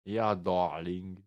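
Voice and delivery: slow, drawn-out British "darling"; mock British accent. Player teasing about his British accent, mock British accent. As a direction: ham up a British accent, very slowly. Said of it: "T'aimes mon accent britannique".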